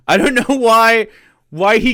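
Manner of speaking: high loud laugh into the words. Real, not acted. Streamer reacting to the clip, laughing loud and high. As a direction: laugh it out in disbelief.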